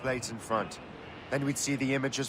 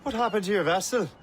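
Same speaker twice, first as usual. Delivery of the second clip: loud, higher call across the water; hailing; 6 semitones higher, 4 dB louder than usual. Acted. Captain hailing the Chevalier across the water, loud call. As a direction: hail him from ship to ship.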